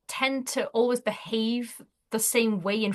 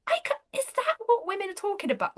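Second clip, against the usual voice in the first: shocked question; 10 semitones higher, faster than usual. Retold or imitated. Husband shocked after fitter leaves.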